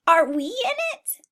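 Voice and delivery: high bleating question; eager sheep. Acted. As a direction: ask hopefully like a lamb.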